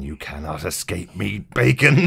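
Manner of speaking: hunt him down with the last word; imitating Nebula. Podcaster voices Nebula hunting Bacon, menacing then loud. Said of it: "Nebula going".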